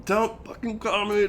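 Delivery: high-pitched retold outburst; angry cursing. Boyfriend cussing out girlfriend after the prank, angry. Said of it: "never said more cuss words".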